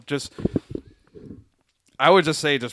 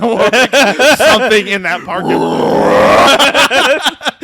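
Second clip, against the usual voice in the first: laughing; 9 semitones higher, 12 dB louder, much slower than usual. Real, not acted. Joking about a cursed lot.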